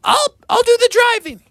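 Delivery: high mother voice; eager mother. Acted. Mother Bear volunteers to drive, high and eager. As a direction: offer it brightly. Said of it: "And Mother said".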